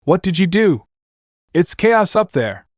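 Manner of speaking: mildly outraged accusation; angry shout. A narrator's reading. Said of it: "Master Ox shouted angrily".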